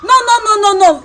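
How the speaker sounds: sharp high no no no; loud refusal. Acted. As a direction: shut it down loudly. Refusing the camera loudly.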